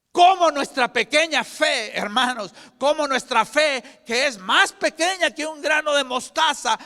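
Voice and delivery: loud, high, ringing preacher's cry; preaching at full force. Acted. Preacher rallying the congregation, loud and high. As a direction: build the faith call up to a shout.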